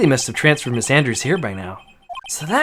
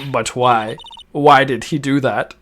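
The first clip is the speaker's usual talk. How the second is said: ordinary talk, nothing marked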